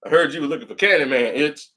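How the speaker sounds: lightly voiced movie character; movie line recital. Shown as a demonstration. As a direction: deliver the line like the film. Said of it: "Say the line".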